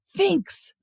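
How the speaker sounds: very high, sharp yell; boy's shout. A narrator's reading. Boy shouts from the Phelps house, a high-pitched yell. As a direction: shout the insult from a window. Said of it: "a boy shouted from the Phelps house".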